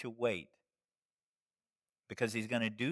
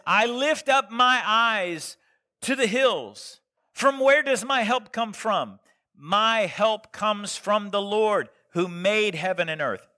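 scripture reading; 9 semitones higher, 11 dB louder than usual. Acted. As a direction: proclaim the psalm to the church. Reads Psalm aloud in preaching voice.

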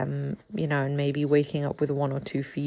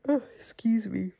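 hiccup and yawn; 5 semitones higher than usual. Real, not acted. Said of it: "a hiccup and a yawn at the same time".

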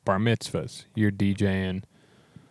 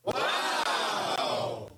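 breathy wow; 4 dB quieter than usual. Real, not acted. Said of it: "a very Skippy wow".

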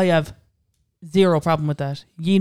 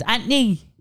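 Anthony cut to two syllables, "Ant-ny", pitched up; higher north side "Ant-ny"; north side accent; 6 semitones higher, slower than usual. Shown as a demonstration. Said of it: "in the north side".

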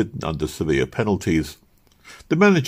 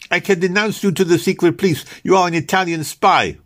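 frightened threat; 11 semitones higher, 5 dB louder than usual. Acted. Frightened rival threatens back.

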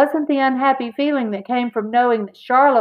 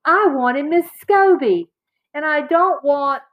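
put-on high, indignant boy's complaint; heated, aggrieved outburst breaking off; upset protest; 6 semitones higher than usual. A narrator's reading. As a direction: push the pitch up in angry objection. Angry boy protests class merger.

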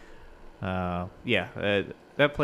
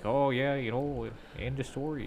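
put-on a light Upper Midwest accent, pitched higher, trailing softer; mock Minnesota accent; 4 semitones higher, 6 dB quieter than usual. Mocking Midwest locals to a friend, light Minnesota accent. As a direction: toss off the words like a folksy Minnesotan. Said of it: "shit that they're like".

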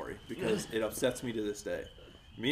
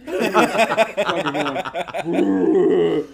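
slow, loud, laughter-choked words; can barely get it out for laughing; 5 semitones higher, 10 dB louder, much slower than usual. Real, not acted. Telling friends about Kyle, laughing through it.

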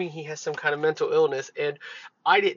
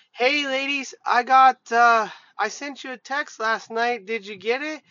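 higher-pitched voice imitating a woman calling over; imitated friendly lady; 7 semitones higher, 4 dB louder than usual. Other ladies walk down to the two females, high imitated voice. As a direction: put on a high, chatty lady's voice.

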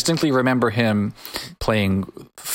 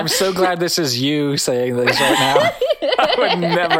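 laughing; 4 semitones higher than usual. Real, not acted. Amused by tricky pronunciation.

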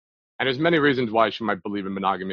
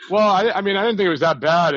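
put-on girl backpedalling; 9 semitones higher, 7 dB louder than usual.

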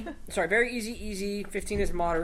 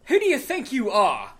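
louder, higher, indignant challenge; angry horse owner; 6 semitones higher, 12 dB louder than usual. Acted. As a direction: confront the stranger, offended.

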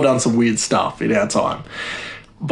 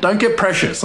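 ordinary talk, nothing marked

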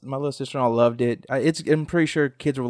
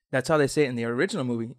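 usual speaking voice

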